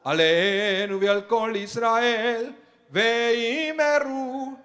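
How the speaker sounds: fast, high chanted Kaddish; rapid prayer recitation. Acted. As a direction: rattle the Kaddish off quickly.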